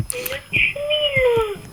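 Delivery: slow, stretched high "tuile"; drawn-out high voice. Pretend. Kids repeating a catchphrase. (voix aiguë et traînante)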